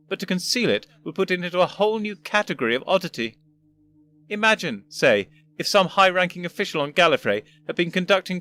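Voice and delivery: put-on a fussy old gentleman explaining; first doctor voice.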